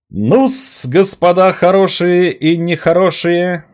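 high, loud, drawn-out fairground call; loud carnival barker. Acted. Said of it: "примеряя на себя роль ярмарочного зазывала".

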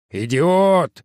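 furious yell at a subordinate; angry shout. Acted. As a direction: bellow the insult. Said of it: "заорал начальник".

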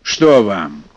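stern. Acted. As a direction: grill them sternly like a grumpy elder. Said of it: "сурово спросил".

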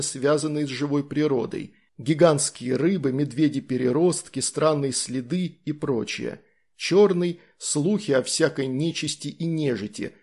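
ordinary delivery, nothing marked

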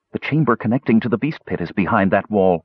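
ordinary delivery

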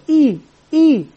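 "إي" said twice, each a separate held vowel; focus on letter sound. Shown as a demonstration. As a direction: model "إي" clearly as a long ee sound. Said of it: "والتركيز على صوت الحرف".

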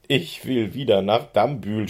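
pony wailing to go home, understated; mild storybook yell. A narrator's reading. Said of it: "schrie er".

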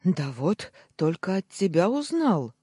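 loud, rising, flustered bleat; plaintive bleat. A narrator's reading. Uncle clutching his head, plaintive bleat. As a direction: bleat it out in dismay. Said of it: "проблеял он".

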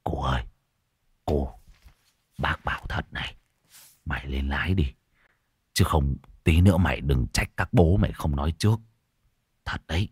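low, soft, partly whispered; hushed warning. Acted. Man whispers a warning into the driver's ear, hushed. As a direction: lean in and murmur it. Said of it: "thều thào ghé tai".

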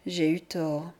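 plain reading voice

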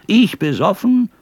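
put-on loud, high-pitched drunken scolding; angry shouted rant. Thrown-out drunk rants at the inn, angry shouting. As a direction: rail at them at the top of your voice. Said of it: "mit solcher Geschwindigkeit gegen das Haus loszuschimpfen".

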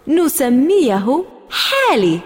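high, eager hand-raising answer; bursting to answer the teacher; eager child. Acted. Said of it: "رفعت يدي وقلت بحماس". (طفلة متحمسة تجيب المعلمة)

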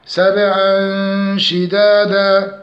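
slow سبعا شدادا with a nasal hum before sh; chanted سبعا شدادا; hidden nun. Shown as a demonstration. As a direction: hide the n of سبعا in a held nasal sound. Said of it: "التنوين مع الشين إخفاء حقيقي".

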